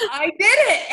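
high, loud gleeful confession; emphatic admission. Real, not acted. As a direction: proclaim it after a burst of laughter. Confession repeated emphatically after laughing.

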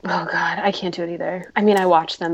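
ordinary talk

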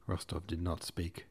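usual speaking voice

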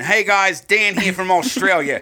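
mock Australian bloke reading a fan message; over-the-top Australian accent. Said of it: "I'm gonna do it in the fucking offensive accent".

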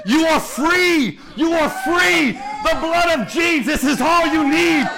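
high, loud shouted preaching; preaching at full force. Acted. Pastor shouting the gospel to his church, fervent. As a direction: build to a shouting crescendo.

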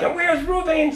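high-pitched imitation of others asking; mimicked calling out. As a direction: mimic a worked-up group wanting someone. People in the room call for him.

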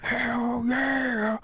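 stage whisper sung high and slow; whisper-shout the rock chorus; whisper yelling. Pretend. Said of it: "You're, like, whisper yelling this".

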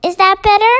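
ordinary delivery, nothing marked

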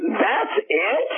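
high, disbelieving questions; can't believe the trip was for this; incredulous. Retold or imitated. Retelling his outraged disbelief.

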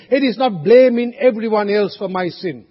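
usual speaking voice

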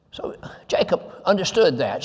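breathless hushed aside; whisper. Real, not acted. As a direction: drop to a whisper. Aside after preaching point.

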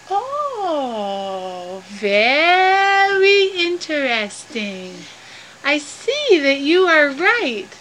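drawn-out, musing character voice; slow pondering. Acted. Mel taking in Inchi's explanation, slowly musing. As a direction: stretch each word as the idea sinks in.

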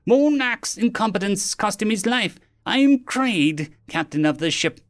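high-pitched villain introducing himself grandly; haughty alien captain. Acted.

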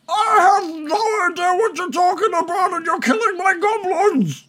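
very high, strained yell; high-pitched furious goblin boss; indignant shout. Acted. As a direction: yell back in outraged protest. Bargle yells back at the party.

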